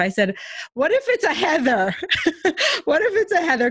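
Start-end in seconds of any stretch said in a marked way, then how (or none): 0.7-1.9 playful retort. Retold or imitated. Playful retort to book quote. 2.0-2.8 a squeal of real laughter, high up; high-pitched burst of laughter. Real, not acted. 2.8-3.7 said through real laughter, pitched high; laughing. Real, not acted. Repeats it while laughing.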